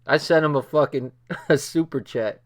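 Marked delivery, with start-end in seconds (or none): none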